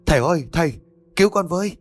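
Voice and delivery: high desperate call for help; woman calling to the sorceress for help; crying out. Acted. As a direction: call out for rescue at the gate. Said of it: "Mai lúc này gào lên".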